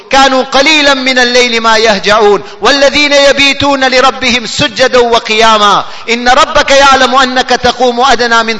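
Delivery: fast, continuous Arabic recital; rapid Quran recitation. Real, not acted. Preacher proving the night's worth with verses, rapid recitation. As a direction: pour out verse after verse without stopping.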